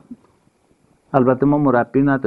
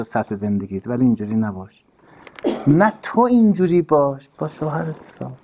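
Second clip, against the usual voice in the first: ordinary talk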